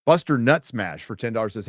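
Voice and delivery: usual speaking voice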